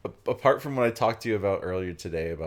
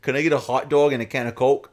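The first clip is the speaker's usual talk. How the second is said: louder, higher mock New York accent; 6 semitones higher, 10 dB louder than usual. At the dining car counter, faking a New York accent. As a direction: order like a local New Yorker. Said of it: "I wanna order one like that".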